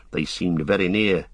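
ordinary delivery, nothing marked

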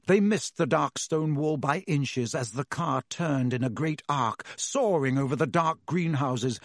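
plain reading voice

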